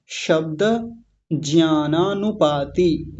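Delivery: शब्द ज्ञानानुपाति slow with every syllable sounded; correct pronunciation demo. Shown as a demonstration. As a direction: sound the final short a of शब्द and stretch the long vowels of ज्ञानानुपाति. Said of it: "इसका शुद्ध उच्चारण समझेंगे".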